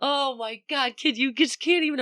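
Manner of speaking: high, thrilled gush; excited. Retold or imitated. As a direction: squeal it like you just can't believe your luck. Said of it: "I was so fucking excited".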